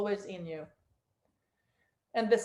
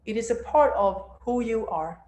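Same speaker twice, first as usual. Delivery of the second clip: guided meditation; 4 dB louder than usual. Acted. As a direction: guide them down into stillness.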